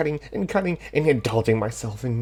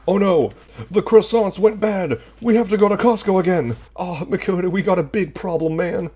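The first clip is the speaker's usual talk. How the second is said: high, loud frantic shouting; panicked guy voice; 10 semitones higher, 8 dB louder than usual. Acted. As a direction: panic over the croissants. Panicked character voice.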